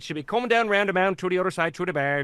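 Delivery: quick, rolling Newfie accent; fluent Newfie accent. Shown as a demonstration. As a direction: rattle it off like a born Newfoundlander. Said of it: "And as soon as they could".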